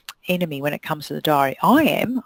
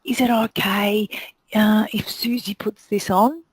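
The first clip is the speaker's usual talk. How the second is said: lightly voiced unsure stylist, a bit higher; timid stylist; 4 semitones higher than usual. Stylist asking a client timidly, unsure. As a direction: ask it meekly, planting doubt. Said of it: "You don't go".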